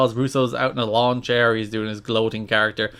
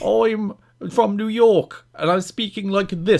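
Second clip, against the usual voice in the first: slow, higher, hammed-up New York voice; mock New York accent; 6 semitones higher than usual. Parodying the wrestler's promo, slow mock New York accent. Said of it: "This is my New York accent".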